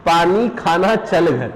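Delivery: पानी खाना चल घर said very slowly, word by word; slow dictation. Shown as a demonstration. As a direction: spell it out slowly for the class. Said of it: "मैंने लिखा बेटा". (धीरे-धीरे लिखवाते हुए)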